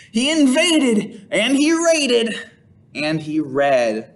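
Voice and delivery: high, slow, each verb punched; rhythmic refrain. A narrator's reading. Reading to children, the big chanted refrain. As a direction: lean on invaded, raided and read.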